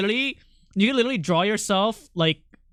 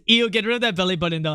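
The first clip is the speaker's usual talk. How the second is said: ordinary talk, nothing marked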